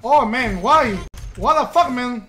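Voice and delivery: loud frustrated outcry; loud exasperation. Real, not acted. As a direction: yell at the game in frustration. Reacting to death loudly.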